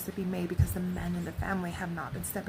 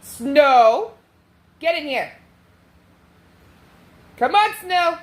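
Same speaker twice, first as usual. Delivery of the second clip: loud call across the yard; calling the dog; 7 semitones higher, 15 dB louder, slower than usual. Real, not acted. Calling Snow the dog inside, a real loud call. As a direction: shout for the dog to come in.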